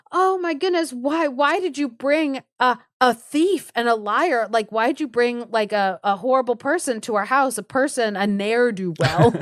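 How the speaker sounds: acted fretful complaining; mock distressed accusation. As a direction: reproach him in hammed-up panic. Wife's feigned distress to husband.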